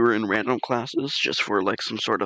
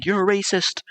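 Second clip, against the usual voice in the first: mock yelling girls; 8 semitones higher than usual. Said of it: "I literally heard them yelling".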